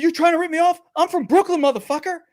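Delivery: indignant high-pitched outburst; retold yell. Tourist to a cheating water seller, yelled indignantly. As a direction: yell it out high, re-enacting your own challenge. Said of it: "I just yelled it out".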